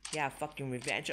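ordinary delivery

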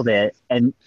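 ordinary delivery

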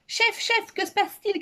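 very high, panicky calling; helpless, high-voiced alarm; distraught cry. A narrator's reading. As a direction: call for the boss in high-pitched dismay. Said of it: "Sa voix tremblait de désarroi".

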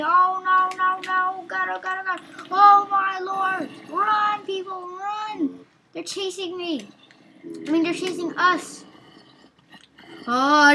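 high loud panicked yelling; being chased. Pretend. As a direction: yell like zombies are right behind you. Fleeing zombies in game.

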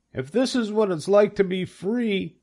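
high, fretful piglet voice; dismayed young pig. A narrator's reading. Cornered pig regretting his freedom, high and fretful. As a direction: pitch the worry right up.

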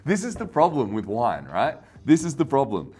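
high and loud, heated rant; ranting about wine, fired up; worked up. Real, not acted. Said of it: "I can actually hear the annoyance in my voice now".

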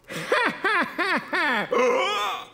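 high staccato laugh ending in a gasp; dying laugh. A narrator's reading. Shot man dies laughing, high acted laugh. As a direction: laugh in high bursts, then expire. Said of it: "He died laughing".

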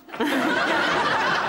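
high, rising giggle. Real, not acted. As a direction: giggle at the naughty idea. Giggles at the caller's suggestion.

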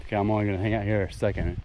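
ordinary delivery

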